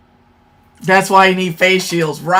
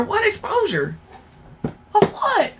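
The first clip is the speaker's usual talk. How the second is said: high, softer incredulous query; baffled questioning; 10 semitones higher, 8 dB quieter than usual. Real, not acted. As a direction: ask high and light, completely lost. Baffled by an unclear chat question.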